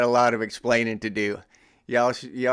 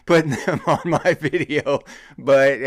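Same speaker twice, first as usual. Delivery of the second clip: higher-pitched, laughing through the words; 3 semitones higher, 5 dB louder than usual. Real, not acted. Host joking to viewers about an unwilling sponsor, laughing. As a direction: say it while cracking up for real.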